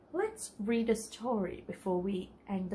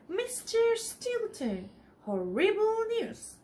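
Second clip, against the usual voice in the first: much higher, slow squeaky alarm; squeaking secretary; 11 semitones higher, 4 dB louder, slower than usual. Acted. Secretary rushing in to warn Stilton, higher squeaky voice. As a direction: squeak it out in a panic. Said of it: "she squeaked".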